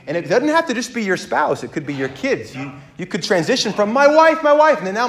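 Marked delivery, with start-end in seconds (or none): 3.9-4.8 high, loud mock gushing; obsessive fixation. Spouse idolizing his wife, mock obsessive gushing. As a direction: harp on it like a doting fanatic.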